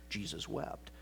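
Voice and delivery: hushed whispered quote; a soft whispered Jesus wept. Retold or imitated. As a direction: breathe the verse out. Quoting the short verse.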